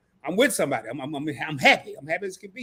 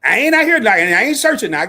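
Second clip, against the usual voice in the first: louder, higher protest; emphatic denial; 5 semitones higher, 10 dB louder than usual. Real, not acted. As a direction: jump in loud to set the record straight. Denying he's looking for a wife.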